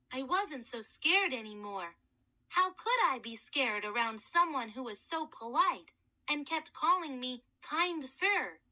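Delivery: ordinary delivery, nothing marked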